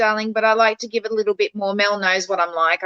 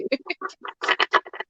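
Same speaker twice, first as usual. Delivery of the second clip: breathy giggle; 8 semitones higher, 6 dB quieter than usual. Real, not acted. Laughs at own tech skills.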